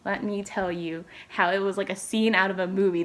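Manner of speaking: ordinary talk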